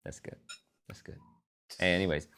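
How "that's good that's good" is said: muttered aside. Real, not acted.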